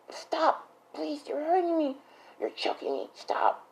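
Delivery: high, strained begging fading to whisper; hammed-up pleading, voice thinning away; mock choked plea. Uraraka to Deku choking her, mock strangled plea.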